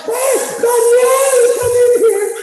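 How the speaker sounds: mock hysterical shriek, very high, loud and slow; frantic screaming. Husband imitating his wife screaming for him to come, very high and loud. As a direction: wail in hammed-up panic calling for help. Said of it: "all of a sudden".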